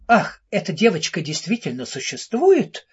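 usual speaking voice

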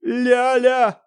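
a loud high cry calling his wife; громогласный вопль; thundering yell. Acted. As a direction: bellow her name across the flat. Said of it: "издал громогласный вопль".

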